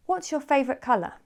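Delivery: "What's" starts high, falling away by "colour"; stress at the beginning. Shown as a demonstration. Teacher models an open question, high from "What's". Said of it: "the stress is at the beginning".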